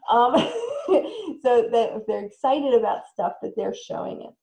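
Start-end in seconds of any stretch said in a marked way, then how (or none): 0.4-1.4 laugh. Real, not acted. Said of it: "Is that a happy?".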